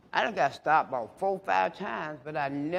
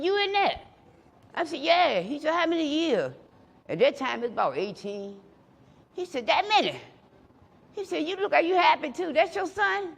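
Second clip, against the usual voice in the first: much higher, friendly questioning; high cop voice; 12 semitones higher than usual. Retold or imitated. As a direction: voice the curious cop.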